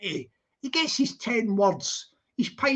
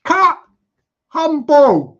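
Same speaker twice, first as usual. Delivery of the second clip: loud shout of cut; louder, exasperated director call; director yelling; 3 semitones higher, 11 dB louder, much slower than usual. Acted. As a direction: stop the take and bark at the actor.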